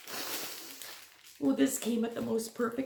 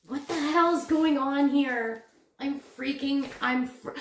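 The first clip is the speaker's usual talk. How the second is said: ordinary talk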